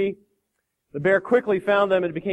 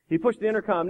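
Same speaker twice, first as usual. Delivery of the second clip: ordinary delivery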